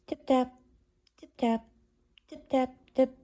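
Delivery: high, light little tip-taps; tiny tapping noises. A narrator's reading. Hidden mice tapping on the dresser, high and light. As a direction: tap it out like tiny mouse feet. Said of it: "there came other little noises".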